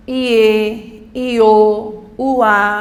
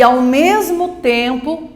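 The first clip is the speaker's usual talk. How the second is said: ordinary talk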